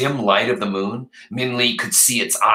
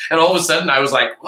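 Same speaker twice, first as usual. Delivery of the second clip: fast, louder mock wail; wailing cry; 6 semitones higher, 4 dB louder, much faster than usual. Retelling a scare at the store, mock wail. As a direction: let out a child's wail.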